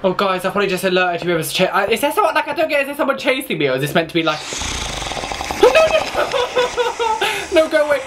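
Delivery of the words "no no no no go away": high squealing no no no, slowed; laughing panic. Real, not acted. Player chased by a horse, laughing in panic. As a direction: shriek away the chaser, half laughing.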